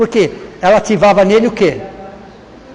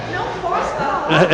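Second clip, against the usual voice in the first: much higher, softer sulky "Não posso falar"; 11 semitones higher, 4 dB quieter than usual. Shown as a demonstration. Speaker showing how tone of voice starts conflicts, high sulky pout. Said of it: "noventa por cento dos conflitos está no tom de voz".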